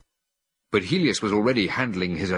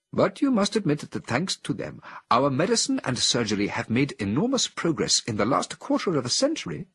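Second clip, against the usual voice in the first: higher, friendly, persuasive voice; lightly voiced Cornelius; gentle ape scientist; 8 semitones higher than usual. A narrator's reading. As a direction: coax the angry visitor kindly.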